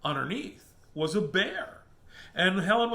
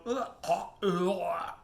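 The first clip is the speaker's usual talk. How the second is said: dad's yell; 3 semitones higher, slower than usual. Acted. Dad sprayed in the face, choked yelling. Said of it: "and he yelled".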